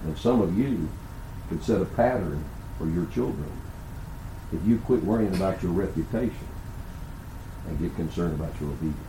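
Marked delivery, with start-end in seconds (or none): none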